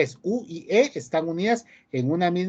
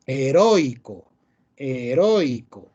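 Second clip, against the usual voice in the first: "Heroico" drawn out slowly, the O strong and i weak; stress on the O; slower than usual. Shown as a demonstration. Teacher demonstrates a diphthong, slow "heroico" with stressed O. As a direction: stretch "heroico", leaning on the O before a weaker i. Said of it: "Que la O es la vocal fuerte".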